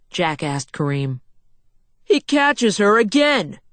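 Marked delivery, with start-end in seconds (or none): 2.0-3.6 raised, louder and slowed for the punchline; mock-proud exclamation; triumphant crowing. A narrator's reading. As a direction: crow it like a proud father. Boss crows about Archie's feat.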